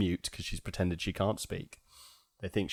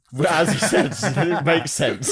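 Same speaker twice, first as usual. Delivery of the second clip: loud, high, laughing delivery; laughing through it; 9 semitones higher, 9 dB louder, slower than usual. Real, not acted. Friend riffing on a film's plot hole, laughing.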